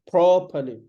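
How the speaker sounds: properly with a pushed P; "properly" heavy at the start, then falling; stressed first syllable. Shown as a demonstration. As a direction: say properly as PRROP-uh-lee. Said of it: "P has the stress".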